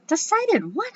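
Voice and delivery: high confused question; startled boy voice. A narrator's reading. Howie startled.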